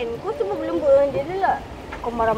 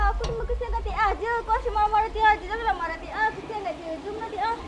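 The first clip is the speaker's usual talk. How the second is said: higher and much softer, gently coaxing; 5 semitones higher, 7 dB quieter than usual. Acted. Friend softly coaxing the upset girlfriend to come away. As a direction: soothe her softly, like calming a child.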